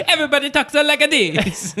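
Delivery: high, exaggerated Italian accent. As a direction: ham up an Italian family accent. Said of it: "Anytime you're, like, eating dinner with your family".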